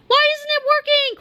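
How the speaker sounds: high whiny cry; panicked old man. A narrator's reading. Old Harold despairs as snapping fails, high panicky cry. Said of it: "cried old Harold".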